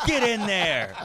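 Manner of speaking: mock shouted order. Teasing about being thrown into an opium den, mock shouted order. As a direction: playfully order someone inside, stretching it out.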